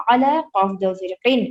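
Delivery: normal talking voice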